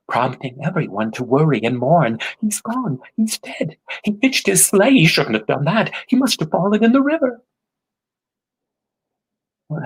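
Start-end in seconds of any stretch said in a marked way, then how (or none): 2.4-7.4 panicked townsfolk. A narrator's reading. Townsfolk panicking over lost boy.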